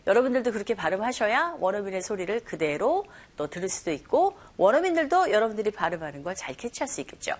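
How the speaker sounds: ordinary talk, nothing marked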